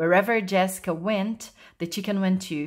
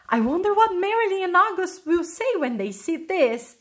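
high-pitched, excited musing; eager wondering; 8 semitones higher than usual. A narrator's reading. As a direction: wonder aloud with anticipation. Jessica thinks eagerly about her find.

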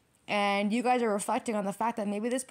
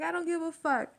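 stubborn; 7 semitones higher than usual. Retold or imitated. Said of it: "you're so stubborn".

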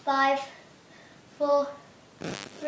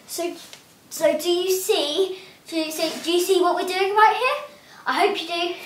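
usual speaking voice

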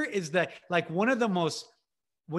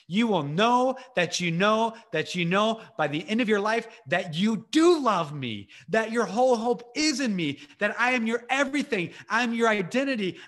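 higher, driving preacher's litany; emphatic divine declaration; 3 semitones higher than usual. Acted. God declares who he is to believers, emphatic and insistent. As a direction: proclaim each title in the same punched rhythm.

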